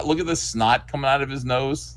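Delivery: usual speaking voice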